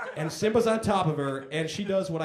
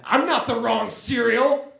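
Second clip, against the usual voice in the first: higher, louder and slow, clearly yelled; indignant drunk shout; 8 semitones higher, 7 dB louder, slower than usual. Retold or imitated. Storming out of a drunk friend's apartment, shouting. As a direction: shout it back, offended and drunk. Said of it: "I said".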